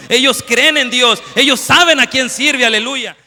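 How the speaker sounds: preacher shouting the prison walls' taunts; preaching at full force. Acted. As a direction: thunder it out over the congregation. Preacher builds to a climax.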